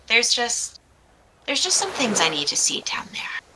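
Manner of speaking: whispered, wistful confession; hushed confiding. Acted. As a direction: let it fade into a whisper. Mariana confides before her dive.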